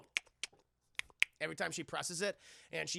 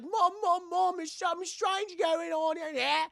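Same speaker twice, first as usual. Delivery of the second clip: put-on high, loud, drawn-out kid voice; imitating a frightened little boy; alarmed child; 13 semitones higher, 7 dB louder than usual. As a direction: call for mom in mock panic. Scared boy runs to his mom.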